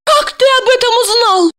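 high, fast amazed woman. A narrator's reading. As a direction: gasp it out in wonder. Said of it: "поразилась она".

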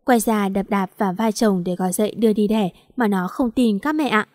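usual speaking voice

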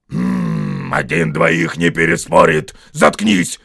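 loud troll order after a growled hum; commanding. A narrator's reading. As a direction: order him to shut up. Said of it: "скомандовал Берт".

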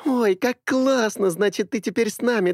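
high, rapid happy chatter; bubbly girl rattling on; too much caffeine. A narrator's reading. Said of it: "Миля радостно затараторила". (радостная тараторка)

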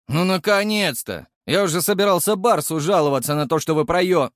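fast, loud, happy blurting out; joyful blurt. A narrator's reading. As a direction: burst out gleefully. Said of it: "радостно выпалил".

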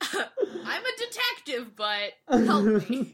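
slow, laugh-broken whining plea; laughing mock plea. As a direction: beg for help while cracking up.

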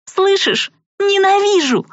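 very high piercing shriek of hate; furious scream. Acted. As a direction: scream it right at him. She screams hatred.

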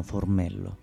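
normal talking voice

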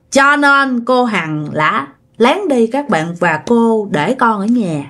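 plain reading voice

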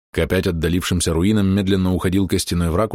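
ordinary delivery

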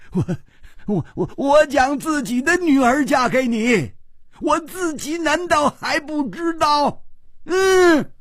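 very high, stammering indignant roar. Acted. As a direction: roar it high, straining with outrage. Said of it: "秋瓷王又怒吼道". (高声怒吼，气得结巴)

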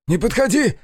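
high-pitched panicked cry; frightened shout. A narrator's reading. Brother shouting at the narrator to keep away, high frightened shout. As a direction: cry out to ward someone off. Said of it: "закричал Колян".

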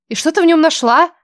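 выше и громче, грозно; напирай грозно. Acted. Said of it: "грозно продолжала подруга". (грозно)

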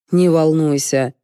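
usual speaking voice

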